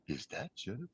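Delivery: very hushed, low murmur of disbelief; low, barely audible question; stunned murmur. Retold or imitated. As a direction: murmur it under your breath, stunned. Stunned murmur at a star sighting.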